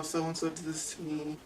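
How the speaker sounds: a weak, half-heard retold mumble; struggling to speak, words slurred and soft; mumbling. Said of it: "Again, like, mumbling".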